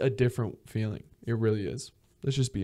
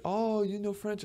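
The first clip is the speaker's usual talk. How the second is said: high, soft mock surprise; high-pitched, gentle "oh really" reaction; impressed onlookers; 10 semitones higher, 8 dB quieter than usual. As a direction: play the impressed stranger, pitched up and light. Acted impressed people asking.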